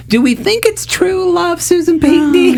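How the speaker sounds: high, playful teasing call-out. Real, not acted. Host teasing Susan about true love in a high playful voice.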